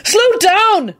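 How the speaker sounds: high-pitched, panicked-sounding bellow; shouted warning, pitch way up. A narrator's reading. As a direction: yell a frantic warning. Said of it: "Neville bellowed".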